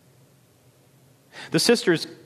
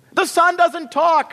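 very high, loud sisterly shriek; 5 dB louder than usual. A narrator's reading. Sisters shriek at Lily's claim, outraged. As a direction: screech it on one high pitch. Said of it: "shrieked the sisters".

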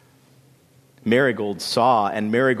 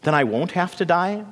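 high, hopeful pleading question; 7 semitones higher than usual. A narrator's reading. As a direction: beg for reassurance, lifting the pitch. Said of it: "Bean Plant pleaded".

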